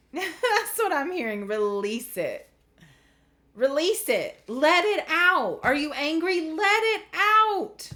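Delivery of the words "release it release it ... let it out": building to an emphatic high cry; forceful urging. Real, not acted. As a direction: urge them loudly to vent their anger. Urging listeners to release anger.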